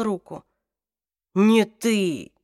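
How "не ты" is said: rasping, dying croak; a choked, rasping warning; hoarse warning. A narrator's reading. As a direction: force it out through blood. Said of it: "прохрипел он".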